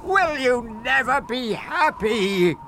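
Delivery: high, slow, loud exasperation; exasperated. A narrator's reading. Magic fish fed up with Frederick, exasperated cry. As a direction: wail it wearily at full voice. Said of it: "it sighed".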